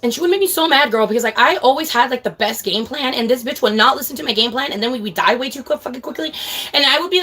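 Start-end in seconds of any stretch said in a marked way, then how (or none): none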